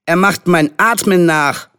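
put-on high, indignant child; angry complaint. A narrator's reading. Charlie telling on Henry, angrily complaining. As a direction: complain like an outraged small boy. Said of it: "hatte Charlie sich wütend beklagt".

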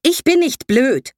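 the aunt cuts her niece off, insulted; slow, loud, emphatic protest; offended outburst. Acted.

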